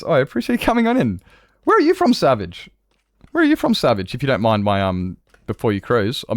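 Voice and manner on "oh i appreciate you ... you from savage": much higher, bright welcoming call; excited welcome. Real, not acted. Streamer welcoming a raider, high excited call. Greeting raider named Savage.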